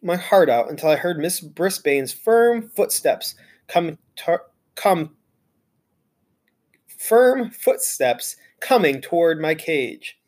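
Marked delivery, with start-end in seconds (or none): none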